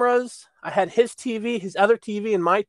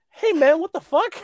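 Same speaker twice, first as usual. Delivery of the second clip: indignant surprise; 10 semitones higher than usual. Retold or imitated. Friend's indignant reaction retold.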